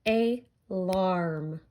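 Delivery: "Alarm" in two slow parts, ay, larm; split into syllables. Shown as a demonstration. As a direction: pause after the first A, then draw out larm. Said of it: "sound that you hear in the second part".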